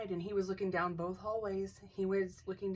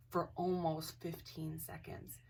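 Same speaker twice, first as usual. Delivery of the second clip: ordinary talk, nothing marked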